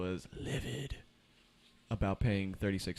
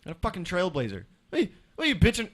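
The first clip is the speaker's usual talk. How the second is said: higher and louder ranting; heated rant; 8 semitones higher, 9 dB louder than usual. Real, not acted. Friend ranting to friends about Trailblazers, heated. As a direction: vent about the cheap car.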